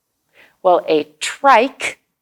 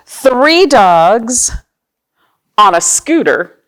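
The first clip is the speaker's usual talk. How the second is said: slow, sing-song picture book reading; reading to children; 4 dB louder, slower than usual. Acted. Librarian reads picture book to children, slow and sing-song. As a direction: read each page slowly to little listeners.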